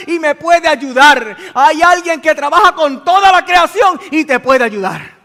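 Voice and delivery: loud, high, driving pulpit voice; preaching at full force. Acted. Preacher rousing the congregation, full-force preaching. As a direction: build it up and hammer it home to the congregation.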